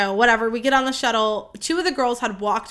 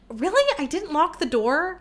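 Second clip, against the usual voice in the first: higher-pitched incredulous question, retold; disbelief; 6 semitones higher than usual. Telling listeners how she doubted she left door unlocked, incredulous. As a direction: replay your own puzzled disbelief, voice lifted.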